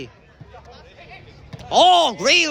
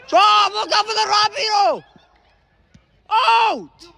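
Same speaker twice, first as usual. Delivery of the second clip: very high loud call; excited shout; 11 semitones higher, 3 dB louder than usual. Real, not acted. Commentator calling a goal chance, very high loud shout. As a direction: yell the play as it happens. Calling a big play live.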